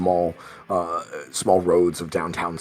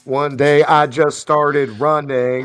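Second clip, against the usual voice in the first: slow, high Southern simpleton drawl; Forrest Gump; 8 semitones higher, 8 dB louder than usual. Acted. Game master quoting a beloved movie, slow drawl. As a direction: draw it out high and loud. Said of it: "I've always loved Forrest Gump".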